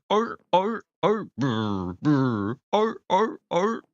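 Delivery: high, loud seal barks; barking like a seal. Retold or imitated. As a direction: bark like a seal, arr arr. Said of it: "do an impression of a seal".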